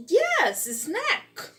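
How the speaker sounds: yes snack, jumping high and loud; a waiter happily insisting; eager waiter. Retold or imitated. Waiter cheerfully confirming the dish to the friend, high and bright. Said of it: "E ele".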